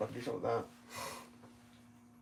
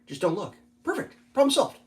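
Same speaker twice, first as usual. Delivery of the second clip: normal talking voice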